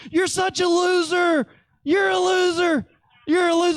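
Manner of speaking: taunting big brother, sing-song jeer; mock taunt. Big brother taunting his little brother after a game, mock jeer.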